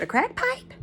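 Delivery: retold disbelief, high and hushed; incredulous question. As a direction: echo it back softly, pitch shooting up. Retells her startled reply on phone.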